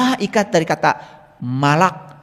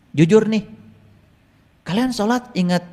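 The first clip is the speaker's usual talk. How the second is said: usual speaking voice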